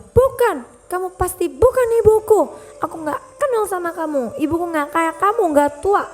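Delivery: high, scornful rejection; harsh denial. Acted. Son rejecting his kneeling mother. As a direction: brush her off with scorn.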